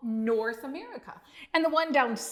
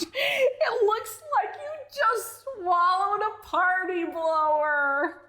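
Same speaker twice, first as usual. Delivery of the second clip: much higher, giggling through the words; laughing; 7 semitones higher, slower than usual. A narrator's reading. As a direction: tease the frog while laughing. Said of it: "Duke laughed".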